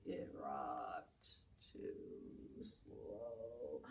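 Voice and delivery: slow everything right down to a whisper. Shown as a demonstration. Storyteller whispers slowly to show the opposite of fast. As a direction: drag out "too slow" in a hushed breath. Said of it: "what's the opposite of fast".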